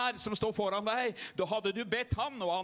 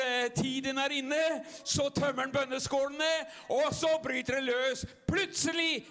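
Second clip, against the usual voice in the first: full-force preaching; 5 semitones higher than usual. Acted. Pastor firing up the revival meeting, full-force preaching. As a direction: lift the crowd to its feet.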